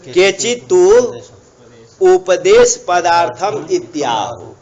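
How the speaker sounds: slow, measured chant of the commentary line; Sanskrit recitation. Acted. Teacher reading the Sanskrit commentary to students, slow chanted recitation. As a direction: intone each word of the Sanskrit like scripture.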